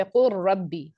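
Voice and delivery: कुर रब्बी with the L vanished into a doubled R; no L at all in कुर रब्बी, just a held R; merged lam into ra. Shown as a demonstration. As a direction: join कुर to रब्बी on one strong R. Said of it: "लाम को हम totally रॉ के अंदर मर्ज कर देंगे".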